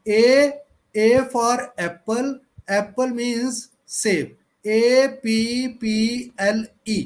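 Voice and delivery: slow, deliberate reading aloud, each letter separate; loud and slow. Shown as a demonstration. Teacher demoing for students a slow, loud alphabet drill. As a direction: read it out slowly and a bit loud like a drill. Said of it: "ऐसे थोड़ा ऊंचा बोलना है".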